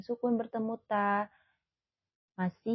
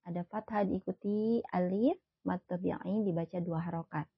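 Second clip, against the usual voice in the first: ordinary talk, nothing marked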